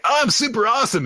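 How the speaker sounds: high-pitched gleeful boast; put-on cocky high voice; boastful boy. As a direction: brag in a high, peppy voice. Acting boastful Asta.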